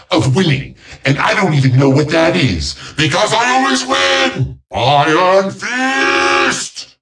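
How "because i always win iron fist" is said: high, drawn-out triumphant cry; boastful villain shout. Acted. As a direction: roar your boast like a petulant overlord. Villain boasts at full force.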